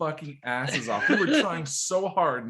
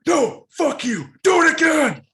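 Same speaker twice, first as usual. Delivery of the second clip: high, loud angry shout; pissed drill instructor; 9 dB louder than usual. Acted. Drill instructor rejecting a stuttering recruit, angry yelling. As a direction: bellow the order, voice pushed way up. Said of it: "drill instructor gets pissed and goes".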